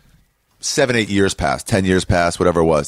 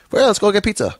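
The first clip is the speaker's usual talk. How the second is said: much higher, breezy and unbothered; casual bloodied brawler; 13 semitones higher than usual. Retold or imitated. A bleeding Boston guy suggesting pizza, breezy and much higher. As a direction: shrug it off like it's nothing.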